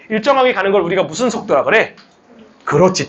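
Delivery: usual speaking voice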